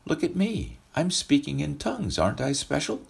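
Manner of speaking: high, mocking, self-important preen; mock boastful pride. Preacher mocks proud Corinthians, high boastful bragging voice. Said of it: "Spiritual chest puffing".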